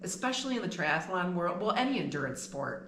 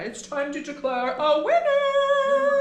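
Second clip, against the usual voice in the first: loud, high, drawn-out game show proclamation; sing-song announcer; 9 dB louder, slower than usual. Acted. Host declaring the pie contest winner, big sing-song announcer. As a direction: sing out the verdict, stretching the last word.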